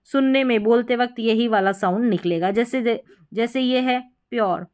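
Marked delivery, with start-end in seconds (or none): none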